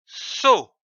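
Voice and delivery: "So" said slowly and distinctly as a model; clear syllable demo. Shown as a demonstration. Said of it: "c'est dit O".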